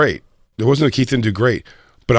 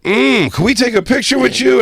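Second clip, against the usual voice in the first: much higher, sweet fangirl ask; mock fan voice; 12 semitones higher than usual. Fans interrupting to ask the comic for photos, high cooing voice. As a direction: coo it like an eager fan.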